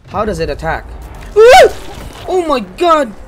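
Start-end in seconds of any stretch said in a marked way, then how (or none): 1.4-3.1 startled shout. Real, not acted. Creature attacks.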